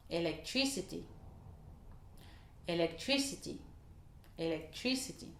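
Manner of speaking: "electricity" with stress on TRI; English stress on TRI. Shown as a demonstration. Teacher models English "electricity" for French learners. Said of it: "électricité se dira electricity".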